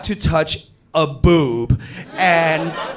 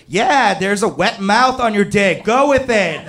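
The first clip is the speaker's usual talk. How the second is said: high, eager egging-on; voice of his body; 6 semitones higher than usual. Retold or imitated. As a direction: urge it on as the body, pitched well up. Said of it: "my body was saying".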